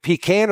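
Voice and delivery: "pecan" said as pee-CAN; preacher polling the congregation, "pecan" as pee-CAN; first pronunciation. Shown as a demonstration. Asking the church which way, "pecan" with a short-a CAN. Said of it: "is it pecan or pecan".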